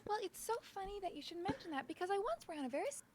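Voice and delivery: sly character voice. Acted.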